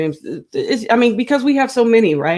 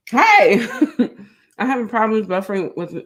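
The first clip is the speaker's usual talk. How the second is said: ordinary talk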